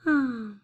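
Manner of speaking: falling, voiced "ah" sigh; demonstrated sigh. Shown as a demonstration. Reader showing children how to sigh, demonstrated sigh. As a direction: sigh it out for the listeners. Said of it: "give me a sigh".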